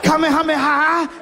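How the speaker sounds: very high mock anime battle yell; strained, high-pitched fighting cries, hammed up; TV anime attack cry. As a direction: desperately imitate a cartoon hero's special move. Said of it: "doing stuff I see on T V".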